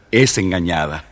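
usual speaking voice

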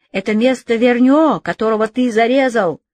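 angry shout. Acted. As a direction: hurl the accusation. Deputies shouting Robespierre down.

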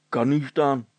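ordinary delivery, nothing marked

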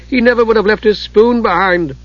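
high-pitched tearful wail, narrated; acted sob. A narrator's reading. Usha grieves over the missing Kender's things, acted sobbing. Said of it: "Usha sobbed".

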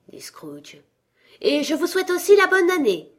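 a lightly voiced young nephew, earnest and bright; cheerful nephew. Nephew defending Christmas to his miserly uncle, earnest.

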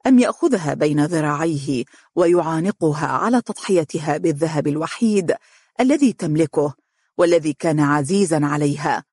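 plain reading voice

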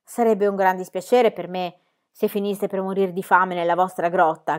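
ordinary delivery, nothing marked

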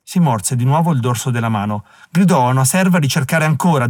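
ordinary delivery, nothing marked

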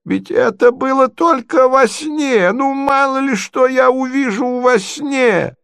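high, slow, plaintive protest; prince crying out. A narrator's reading. Prince defends himself to the ladies, high and protesting. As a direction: protest in a stretched-out, high old-prince cry. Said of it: "закричал князь".

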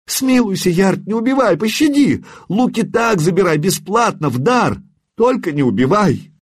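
high, panicked pleading for mercy; terrified begging. A narrator's reading. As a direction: beg for your life in terror. Merchant begs for his life.

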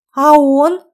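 loud, high, eager gasp; gasped question. A narrator's reading. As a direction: gasp it out, agog for the answer. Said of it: "ахнула Кира". (ахнув от изумления)

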